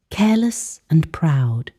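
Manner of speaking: plain reading voice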